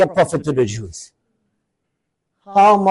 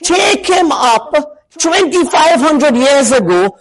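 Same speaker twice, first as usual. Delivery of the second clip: high, rising, near-shouted objection; mock a skeptic crying out his challenge; imitating a doubting listener; 7 semitones higher than usual. Preacher voicing a skeptic's challenge, high and shouting. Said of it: "You say".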